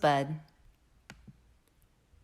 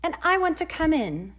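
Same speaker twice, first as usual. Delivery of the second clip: higher, bright cheery sun; soft cheery voice; 9 semitones higher, 4 dB louder than usual. A narrator's reading. The sun asks the rosebud to let it in, cheery and high. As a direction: chirp it up happily. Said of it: "said a soft, cheery voice".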